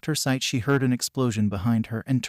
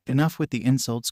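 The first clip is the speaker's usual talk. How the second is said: usual speaking voice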